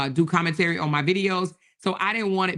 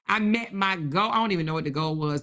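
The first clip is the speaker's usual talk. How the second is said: normal talking voice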